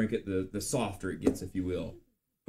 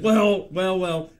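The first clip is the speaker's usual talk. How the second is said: higher, louder, knowing "well, well, well"; hammed-up sly friend; mock sly "well well well"; 9 semitones higher, 9 dB louder, slower than usual. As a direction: tease like a schemer with a secret. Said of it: "And he said".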